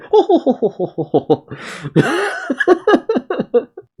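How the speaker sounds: high, drawn-out run of mock "oh-ho-ho" hoots; imitated "ohohoho". Reacting to alien designs, imitated high "ohohoho". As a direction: imitate a long string of high hooting laughs. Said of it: "No one just going".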